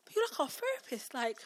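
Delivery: high, pleading mock lament; heartbroken student impression. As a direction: plead like a pupil losing their therapist. Looked like I broke his heart.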